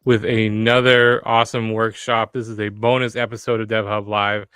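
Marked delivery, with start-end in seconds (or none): 0.0-2.3 loud, drawn-out show opener; livestream host opening. Acted. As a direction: announce the show is live, big and loud. Opens the live show.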